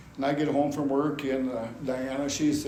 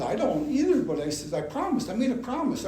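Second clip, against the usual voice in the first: higher-pitched, defensive reply; protesting; 8 semitones higher than usual. Retold or imitated. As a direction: protest to wife in a retold argument. Retold argument with wife.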